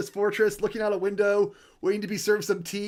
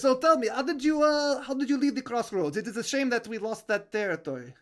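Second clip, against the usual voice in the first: put-on thick French accent, higher at first; French-accented spymaster, pitched up then settling; Dubois; 4 semitones higher than usual. Spymaster asking about the escape, high French accent. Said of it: "Could you say that without the accent".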